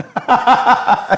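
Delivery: high rueful laugh; high laugh at himself. Real, not acted. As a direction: laugh at your own screwup. Laughs at his failure.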